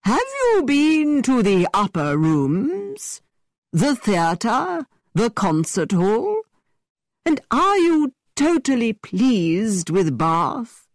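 high, slow, theatrical gentleman; hammy stage actor. A narrator's reading. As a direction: ask grandly like a stage actor. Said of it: "continuing with his actor's voice".

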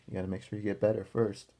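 ordinary delivery, nothing marked

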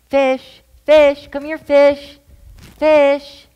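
high, loud calling down the street; yelling for cat. Retold or imitated. Searching the street, calling the missing cat loudly. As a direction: call the lost cat from far off. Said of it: "went up and down her Ave yelling".

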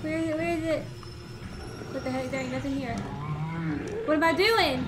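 frantic, fleeing in fear; being chased. Real, not acted. Chased by monster in game.

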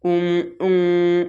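উম held on a humming nasal m; ghunna. Shown as a demonstration. Teacher showing students the nasal hold, ghunna. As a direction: let the m of উম ring through the nose. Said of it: "এখানে গুণ না হবে".

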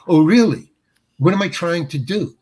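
usual speaking voice

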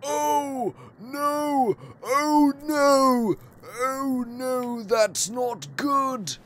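high, loud frightened yelps of oh no; panicked cries. Real, not acted. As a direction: cry out in real alarm. Panicked reaction in horror game.

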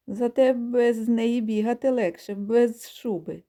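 cheeky ram retorts, last words slow; ram shouting back. Acted. Sheared ram answers the geese, cheeky slow retort. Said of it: "кричить у відповідь баран".

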